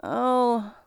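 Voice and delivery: mildly let-down "oh"; disappointed. A narrator's reading. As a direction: let the letdown show a little. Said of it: "she said, disappointed".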